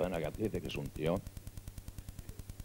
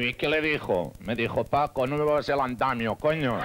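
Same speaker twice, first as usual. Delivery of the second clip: voz alzada de personaje; 5 semitones higher, 6 dB louder than usual. Acted. Chistes con voces de personajes.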